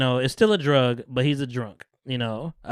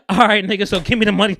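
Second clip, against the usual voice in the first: raised, louder retold demand breaking into laughter; pushy imitation, higher and louder; mock demand; 7 semitones higher, 7 dB louder, faster than usual. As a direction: demand the cash, hammed up and amused. Retelling Franklin mockingly demanding his money.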